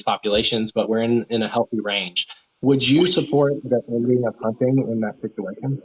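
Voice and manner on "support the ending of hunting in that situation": muffled, as if through a cupped hand; muffled mic. Real, not acted. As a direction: speak with the mic half covered. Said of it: "your mic just muffled at the very end".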